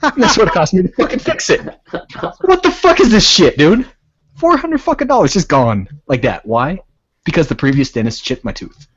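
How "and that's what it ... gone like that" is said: high, heated rant; angry rant. Real, not acted. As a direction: fume about the cost. Ranting about dentist bill.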